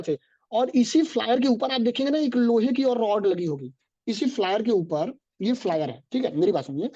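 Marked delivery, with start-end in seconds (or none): none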